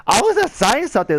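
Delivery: high-pitched mock protest. Man on the bus defending himself to the woman, protesting.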